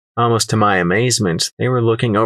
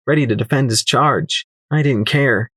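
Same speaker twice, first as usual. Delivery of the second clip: usual speaking voice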